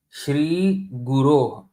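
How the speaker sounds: "Shri Guru" slow, "Shri" held long, "Gu" short, "ru" long; drawn-out "Shri Guru" showing long and short beats; long and short syllables. Shown as a demonstration. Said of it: "Shri is also long".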